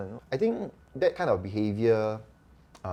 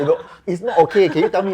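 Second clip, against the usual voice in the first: laughing insistence; 10 semitones higher, 12 dB louder than usual. Retold or imitated. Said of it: "Then I'm like".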